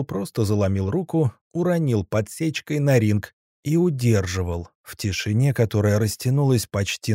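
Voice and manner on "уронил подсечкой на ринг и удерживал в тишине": ordinary delivery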